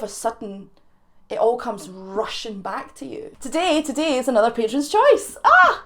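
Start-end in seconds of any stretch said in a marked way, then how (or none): 5.4-5.8 excited squeal. Real, not acted. Excited exclamation.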